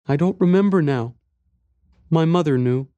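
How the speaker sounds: put-on high, small child voice; little boy. A narrator's reading. Boy tells stranger about lost mother, little boy's voice. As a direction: speak as a young boy, pitched high. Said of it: "Then he said".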